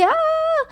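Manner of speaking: high, held mock scream at normal volume; imitated scream. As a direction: let out a sustained, high-pitched pretend cry. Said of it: "elle a commencé à crier".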